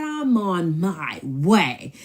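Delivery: loud, every word dragged out; retold loud, stretched comeback; defiant retort. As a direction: holler it back, slow and high. Acted quarrel, her defiant retort.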